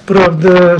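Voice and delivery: usual speaking voice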